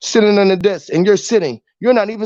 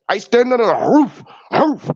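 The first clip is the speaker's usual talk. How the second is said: dog-bark wordplay; 2 semitones higher than usual. Acted. Answering "what you tell 'em", barking "roof" like a dog. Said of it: "The dog barks like roof".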